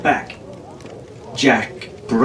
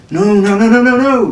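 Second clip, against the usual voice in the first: rising frustrated "no"s, louder and higher; exasperated, getting more fed up with each no; 8 semitones higher, 4 dB louder than usual. Acted.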